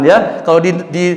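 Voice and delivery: ordinary delivery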